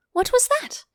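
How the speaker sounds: eager little question; high curious girl. A narrator's reading. Alice asks eagerly.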